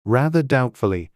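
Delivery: plain reading voice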